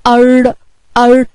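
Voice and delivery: "ار" as "arr" with rolled r; sukun sound demo. Shown as a demonstration. Teacher showing children sukun, "ار" ending on rolled r. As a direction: stop "ار" on a vowelless trilled r. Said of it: "فهنقراه ايه؟".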